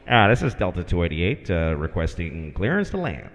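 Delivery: low, calm pilot radio voice; lightly voiced pilot asking to land; a hint of cockpit radio call.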